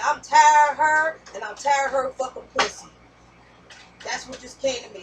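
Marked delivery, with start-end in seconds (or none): 0.0-2.9 mock angry yell. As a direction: shout it out like a man who's had enough. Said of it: "He said".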